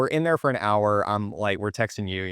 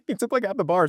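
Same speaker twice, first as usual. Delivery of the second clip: laughing disbelief; 12 semitones higher, faster than usual. Real, not acted. Teasing friend about eating half bar.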